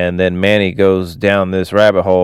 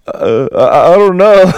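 high, louder mock-tearful voice; imitated crying; 8 semitones higher, 4 dB louder than usual. Manny answering whether he's crying, mock tearful. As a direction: act a bewildered weepy reply. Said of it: "Manny is crying".

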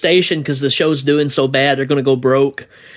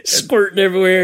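normal talking voice

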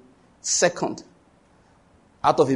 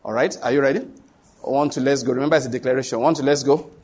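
usual speaking voice

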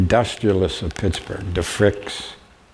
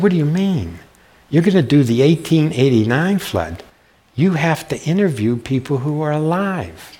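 mock exasperated teacher; 8 semitones higher, 3 dB louder than usual. Teacher insisting on flood topic.

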